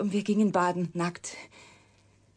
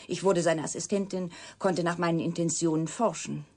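ordinary talk, nothing marked

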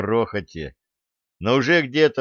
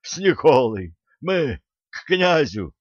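pitched-up, pleading call; anxious cry to the guards; urgent cry; 5 semitones higher than usual. A narrator's reading. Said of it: "вскрикнул Милован".

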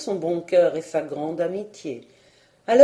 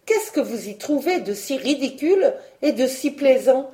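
raised-pitch indignant challenge; annoyed; 5 semitones higher, 3 dB louder than usual. A narrator's reading. Marguerite challenges her laughing cousin, annoyed. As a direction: demand an explanation, mildly cross. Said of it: "dit Marguerite fâchée".

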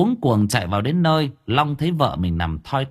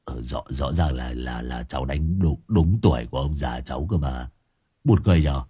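low, halting, stammering man; low stammering husband, dazed; 9 semitones lower than usual. A narrator's reading. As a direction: stumble over the words, baffled. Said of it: "Gã lắp bắp".

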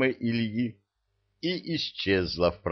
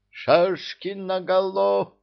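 battle command; 11 semitones higher, 3 dB louder, slower than usual. Acted. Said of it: "скомандовал Чебурген".

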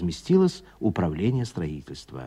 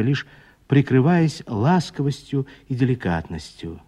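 ordinary delivery, nothing marked

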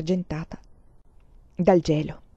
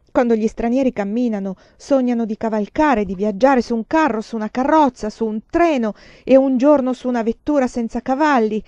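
louder, bitter proud speech; bitter lover; 4 semitones higher, 6 dB louder than usual. Acted. Lover speaks bitterly of Chinese endurance, louder. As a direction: speak with clenched pride.

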